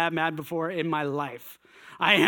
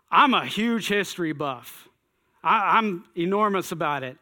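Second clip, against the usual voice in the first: ordinary delivery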